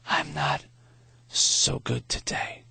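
slow, breathy rasp of a dying man; a failing old man's slow rasp; thin, raspy voice. A narrator's reading. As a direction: barely get the words out, slowly. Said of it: "His voice was thin and raspy".

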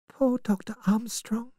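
soft, high lament; hushed pity. A narrator's reading. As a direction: murmur it high and tenderly over the dead. Vera mourns over the dead man.

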